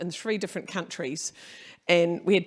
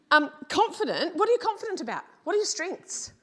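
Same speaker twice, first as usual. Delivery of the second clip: much higher, punchy questions; high energetic question; 9 semitones higher, 4 dB louder than usual. Real, not acted. Speaker to audience, high eager questions. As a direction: lift the room with it. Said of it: "emphatic audience question".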